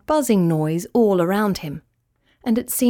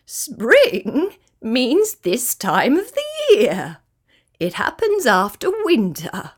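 high, swooping bird voice, drawn-out words; amused kookaburra; 6 semitones higher, slower than usual. A narrator's reading. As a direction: explain it with a merry warble. Kookaburra character explains.

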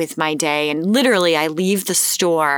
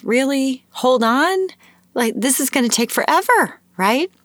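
higher-pitched incredulous inner voice; retold exasperation, pitched up and quick; exasperated disbelief; 7 semitones higher than usual. Acted exasperated thought at the checkout.

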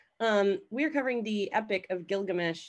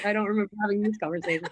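usual speaking voice

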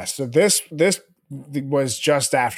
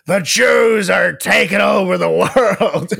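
loud, drawn-out accusatory yell; hammed-up slow outburst at a cop; impersonated ranting outburst; mock drunken rant; 5 semitones higher, 5 dB louder, slower than usual. Acting out Mel Gibson's drunk rant.

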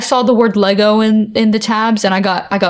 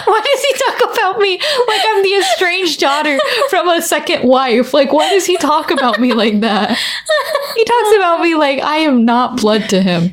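higher, laughing indignation; higher-pitched laughing mock indignation; laughing mock outrage; 8 semitones higher than usual. Real, not acted. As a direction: play offended while cracking up. Mock outrage at dad's comment.

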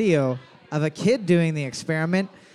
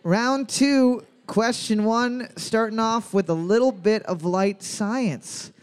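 trivia host announcer; 3 semitones higher, slower than usual. Acted. Host reopens trivia round.

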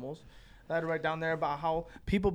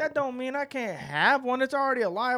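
higher-pitched exasperated argument; retold protest; 6 semitones higher than usual. Pug fan answering his friends' criticism, protesting. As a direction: plead the case, voice pitched up. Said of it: "and I'm like".

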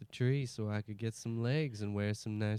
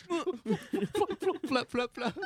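high voice laughing through flaps; mom voice; 13 semitones higher, much slower than usual. Pretend. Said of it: "Shut up, Mom".

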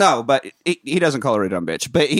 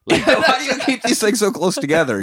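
laughing question; 9 semitones higher than usual. Real, not acted. Repeats friend's question laughing.

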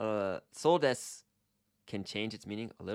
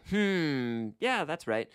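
higher and louder, keen hmm yeah that's right; eager agreement; 7 semitones higher, 6 dB louder, much slower than usual. Shown as a demonstration. Teacher acting out eager agreement, excited. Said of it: "So if I wanna be like".

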